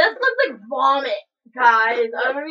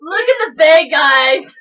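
loud laughing call out; excited shout; 2 semitones higher, 10 dB louder than usual. Real, not acted. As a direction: call everyone to look. Showing the bag excitedly.

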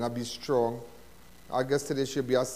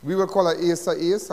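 ordinary delivery

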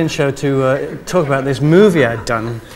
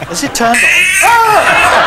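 much higher, loud and slow laughing call; laughing shout; 8 dB louder, much slower than usual. Real, not acted.

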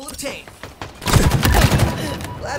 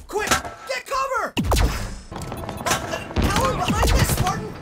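high, drawn-out urgent call; 7 semitones higher, 4 dB louder, slower than usual. Acted. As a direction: call out a warning to a teammate. Urgent call to take cover.

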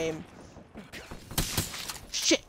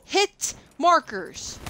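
drawn out slowly; 8 semitones higher, much slower than usual. Real, not acted. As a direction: hit markers dragged out slow. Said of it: "Number one thing that can piss anybody off".

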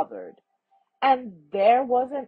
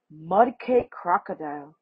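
usual speaking voice

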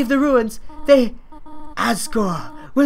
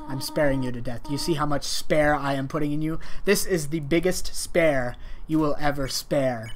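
lower, softer mock-grave talk; hammed-up solemn sincerity; 6 semitones lower, 4 dB quieter than usual. Gamer addressing Toriel, mock-solemn hush.

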